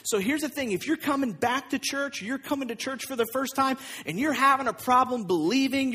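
usual speaking voice

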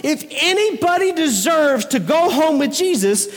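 high, indignant mock protest; a hammed-up indignant neighbor; outraged. Indignant churchgoers voiced.

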